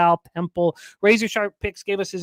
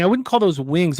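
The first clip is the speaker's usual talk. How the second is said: normal talking voice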